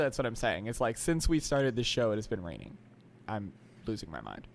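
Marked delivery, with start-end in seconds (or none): 3.3-4.5 very quiet, low resignation; deadpan despair. Real, not acted. Host worn down by months of rain, deadpan.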